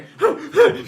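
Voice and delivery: high, loud acted gasp; exaggerated gasp. Reenacting a tired late-night film take, high gasp. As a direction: suck in air theatrically. Acting out being exhausted on set.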